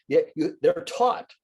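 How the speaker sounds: ordinary delivery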